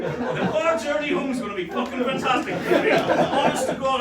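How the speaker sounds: can barely get the words out for laughing. Real, not acted. Comic reacts to the crowd, laughing hard.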